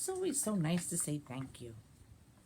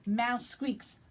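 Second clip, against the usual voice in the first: put-on lively read-aloud for children; animated picture-book reading; story narration; 2 semitones higher, 5 dB louder than usual. A narrator's reading. Librarian reads picture book.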